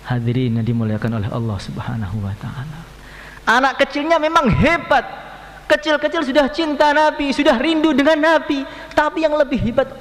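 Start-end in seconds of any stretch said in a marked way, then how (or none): 3.5-10.0 high, loud preacher's praise; rousing sermon voice; impassioned preaching. Acted. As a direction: lift the congregation with admiration.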